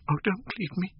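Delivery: a desperate high voiced "Oh, don't leave me" from the young actress; high pleading plea. A narrator's reading. Sybil begging him to stay.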